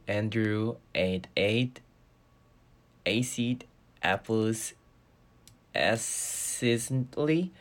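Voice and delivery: very slow, careful tongue twister, word by word. Shown as a demonstration. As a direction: spell out each word of the tongue twister slowly. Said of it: "발음 연습하거든요".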